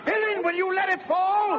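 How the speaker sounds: a sudden explosion of rage; furious shout. Acted. Master shouting at a servant who drops the water, furious roar. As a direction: roar it high and hard at the servant.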